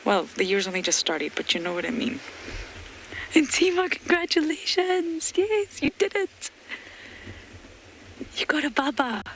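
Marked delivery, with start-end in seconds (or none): 3.4-9.2 much higher, thrilled praise for the new mother; delighted congratulations. Real, not acted. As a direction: cheer her on like a proud friend.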